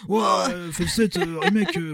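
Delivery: slow, drawn-out mock fanboy awe; Yeti impression. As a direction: stretch it out like a gushing fan. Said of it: "il parle pas du tout comme ça".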